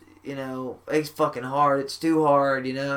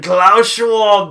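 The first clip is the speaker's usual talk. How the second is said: louder, higher, drawn-out villain voice; evil Klaus Schwab; 6 semitones higher, 8 dB louder than usual. Acted. Podcaster mocking Klaus Schwab to his co-hosts, evil voice. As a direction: announce the name slowly like a cartoon villain. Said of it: "in the most evil voice".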